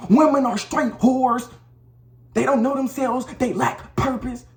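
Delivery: high, loud, slow rant; loud ranting demo. Shown as a demonstration. Demo of ranting at women on camera, loud and high. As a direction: yell it like a hostile rant at the camera. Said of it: "all loud".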